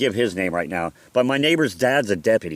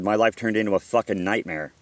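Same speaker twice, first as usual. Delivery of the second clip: ordinary delivery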